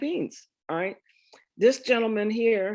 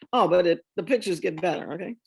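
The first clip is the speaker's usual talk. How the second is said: ordinary talk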